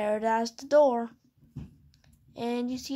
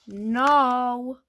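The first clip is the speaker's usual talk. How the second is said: louder, drawn-out no; exasperated refusal; 5 dB louder than usual. Pretend. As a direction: play fed up with the nagging. Third answer to the nagging question.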